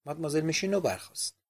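plain reading voice

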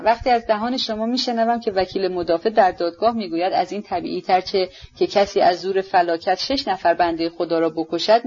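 ordinary delivery, nothing marked

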